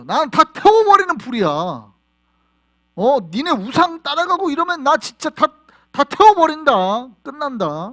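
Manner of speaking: loud high shout, slowing on 다 태워버린다; God threatening idol-chasers; furious threat. Acted. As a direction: roar then settle on the last word. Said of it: "뭐 이런 거죠".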